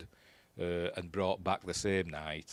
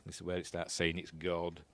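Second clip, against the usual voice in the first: ordinary talk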